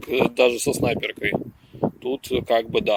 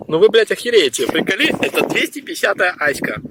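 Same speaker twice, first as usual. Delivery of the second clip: excited disbelief; 5 semitones higher than usual. Real, not acted. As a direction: blurt it out amazed. Shocked at the find.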